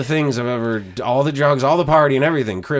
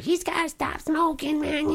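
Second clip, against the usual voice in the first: high mock voice of Lee; imitating Lee; 4 dB quieter than usual. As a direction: squeak it out as a mocking impression of Lee. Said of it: "And Lee's like".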